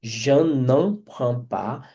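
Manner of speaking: "je n'en prends pas" slow, with "n'en" said nasal and linked; careful pronunciation. Shown as a demonstration. Teacher drills the negative for students, slow and careful. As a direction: slowly spell out "je n'en prends pas", leaning on "n'en". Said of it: "A pronúncia dá mais trabalho".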